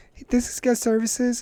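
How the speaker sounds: high-pitched questioning caller; imitated caller. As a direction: ask it high, like a customer on the phone. Acts out caller phoning the store.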